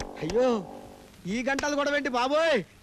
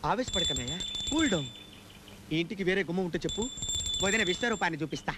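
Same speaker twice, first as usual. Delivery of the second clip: hushed calming; 7 semitones lower, 5 dB quieter than usual. Acted. Calming angry brother, hushed.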